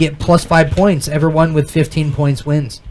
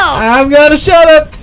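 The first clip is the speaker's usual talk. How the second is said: laughing shout; 8 semitones higher than usual. Real, not acted. Laughing fit at the table.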